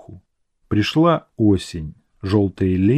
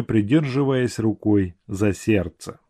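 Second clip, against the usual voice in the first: plain reading voice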